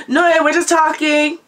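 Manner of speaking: high, put-on casual reassurance; acted innocent cover-up. Teller covering to mum about the visitors, high innocent voice. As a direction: pitch it right up, playing innocent.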